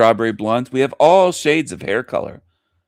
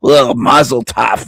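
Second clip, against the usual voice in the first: slow, louder, throaty mock Hebrew accent; mock phlegmy Hebrew accent; 6 dB louder, slower than usual. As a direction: hack out mock Hebrew syllables, slowly. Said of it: "I'm doing the phlegmy Hebrew".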